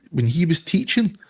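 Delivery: normal talking voice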